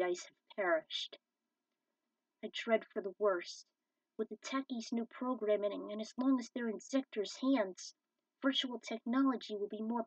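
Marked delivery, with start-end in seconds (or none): none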